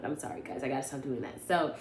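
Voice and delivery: usual speaking voice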